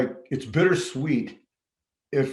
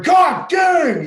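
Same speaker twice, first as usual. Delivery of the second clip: much higher, loud shout "God dang"; hammed-up yell; mock exclaim; 11 dB louder than usual. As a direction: blurt it out like an exasperated cop. Quoting sheriff's outburst.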